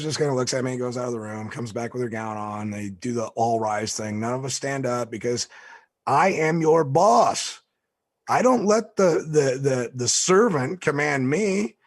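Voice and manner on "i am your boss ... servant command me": slow, louder, raised mock-boss declaration; imperious boss. The boss refusing commands from a servant, mock imperious. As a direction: lay down the law, drawn out and high.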